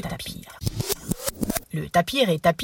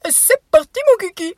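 high, loud goofy shout; silly exclamation; 7 dB louder than usual. Acted. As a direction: yell it like a silly clown. Playful exclaim.

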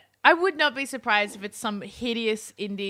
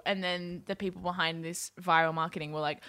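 ordinary talk

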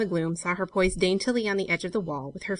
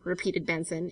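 plain reading voice

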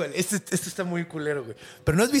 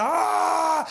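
high mock yell; sudden high monk shout when the spider jumps; monk's shout; 10 semitones higher than usual. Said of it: "Y el monje grita".

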